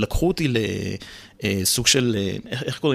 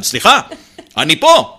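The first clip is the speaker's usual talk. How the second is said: louder, higher call into the air; mock teacher shouting toward the ceiling; 5 semitones higher, 8 dB louder, slower than usual. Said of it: "מורה שמסתכלת תמיד למעלה".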